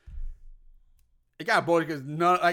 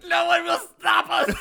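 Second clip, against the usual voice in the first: villain shout; 10 semitones higher, 6 dB louder than usual. Acted. Villain gloating in mock German.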